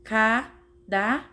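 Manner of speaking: "Cadáver" slowly split into three separate syllables. Shown as a demonstration. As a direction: count out "Cadáver" piece by piece. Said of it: "vamos usar essa regrinha".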